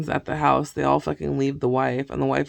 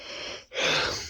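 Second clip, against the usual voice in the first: soft breathy sigh; wordless exhale; 7 dB quieter than usual. Real, not acted. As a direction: let out a quiet sigh. Sighs while losing her thread.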